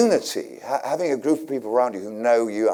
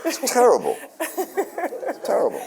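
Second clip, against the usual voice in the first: laughing it out, the second one slower; slower than usual. Real, not acted. Laughing to the interviewer about fame being terrible. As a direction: chuckle through the words, drawing the repeat out.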